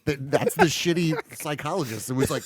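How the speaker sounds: normal talking voice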